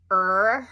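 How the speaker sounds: "Er" with the tongue curled back, said loud; retroflex er. Shown as a demonstration. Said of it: "you put your tongue in the back".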